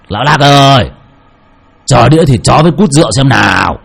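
gọi to hơn, kéo dài chữ ơi hạ giọng; gọi món một cách hách dịch, ra vẻ; hô to khệnh khạng. A narrator's reading. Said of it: "mồm khệnh khạng hô to". (gã khách gọi chủ quán, hô to hơn)

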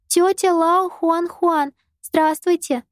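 put-on high, slow careful child greeting; a small girl correcting herself politely; little girl voice. A narrator's reading. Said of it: "Юйшаго поправилась".

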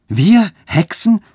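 hoch, laut und gedehnt entsetzt; entsetzter Schrei. A narrator's reading. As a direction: schrei es ungläubig vor Angst. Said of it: "schrien diese mit Entsetzen". (entsetzt aufschreiend)

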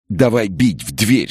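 mild yell, straining upward; cracking-voice yell. A narrator's reading. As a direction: yell it as a panicked boy. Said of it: "срывающимся голосом заорал он".

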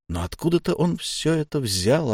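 ordinary delivery, nothing marked

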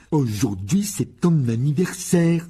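loud, slow sneering jab; high, loud mocking boy's taunt; contemptuous. A narrator's reading. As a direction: spit it out with scorn. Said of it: "lança Dudley d'un ton méprisant".

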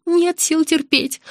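high, slow, suffering whine; drawn-out plaintive complaint; moaning. A narrator's reading. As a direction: moan it as if in pain. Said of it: "простонала незнакомка".

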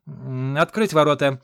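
a sigh, then a loud command; shouted order. A narrator's reading. Aide ordering guards to open the gate, loud shout. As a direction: give in and yell to open up. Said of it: "вздохнув, крикнул".

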